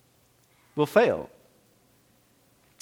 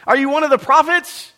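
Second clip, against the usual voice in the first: high, loud demanding questions; pointed interrogation, pitched up; questioning priests; 13 semitones higher, 6 dB louder than usual. Acted. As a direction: press him like suspicious priests.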